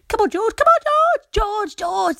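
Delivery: high, loud chorus of animals; very high, loud excited animal voices. Acted. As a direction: call out eagerly like little animals.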